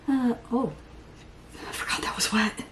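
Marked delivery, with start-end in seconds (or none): 1.7-2.6 hushed, breathy aside; whispered aside. Real, not acted. Surprised by wet product, whispering to herself. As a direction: whisper it to yourself. Muttering to herself while applying.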